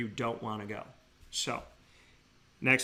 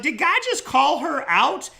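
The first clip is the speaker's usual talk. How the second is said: high, loud and slow, astonished; amazed disbelief; 14 semitones higher, 9 dB louder than usual. Retold or imitated. Host retells his amazed reaction to the Bible. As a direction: gasp out your amazement.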